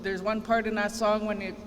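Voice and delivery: normal talking voice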